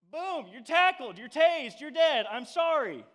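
a hammed-up shout of tackled and tased; loud comic punchline. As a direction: belt it out for the laugh. Comic climax of tea story.

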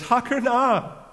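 loud, high, drawn-out declaration; emphatic cry. Acted. Tamar being brought out to be burned, crying out emphatically. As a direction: cry it out slowly as an accusation. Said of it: "na puts emphasis on it".

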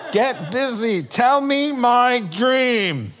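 demanding king. Acted. King ordering the psychics, loud and slow. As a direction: lean in and demand it.

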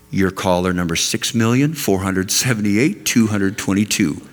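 low recorded hold message; deadpan automated phone announcement; phone menu voice. Retold or imitated.

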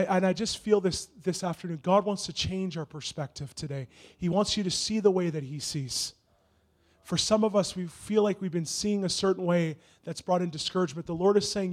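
ordinary talk

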